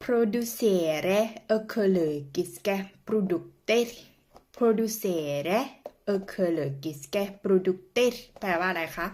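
Producere ekologiske produkter drawn out very slowly in Norwegian sounds; slow Norwegian demo. Shown as a demonstration. Teacher slowly modelling the Norwegian phrase for viewers. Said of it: "นมเนี้ยค่ะ".

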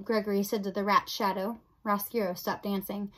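usual speaking voice

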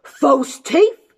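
loud, high incredulous question; giant's roar. Acted. Giant hears of false teeth from Jim, roaring. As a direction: bellow it back in disbelief. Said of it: "roared the giant".

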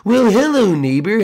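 normal talking voice

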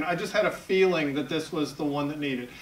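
usual speaking voice

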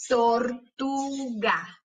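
"Tortuga" very slowly as tor, tu, ga; split into syllables. Shown as a demonstration. Teacher showing closed syllables, syllable by syllable. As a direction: stretch and separate each syllable of "Tortuga". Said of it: "para ver cuáles de esas sílabas".

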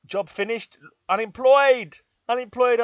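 loud, high proclamation of Unemployed; loud mock-dramatic declaration. Real, not acted. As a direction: announce it like a headline. Dramatic announcement of job loss.

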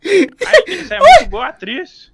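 excited praise. Real, not acted. Friend amazed at the acting, loud excited praise. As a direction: burst out in amazement.

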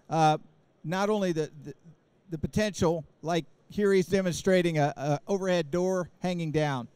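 ordinary delivery

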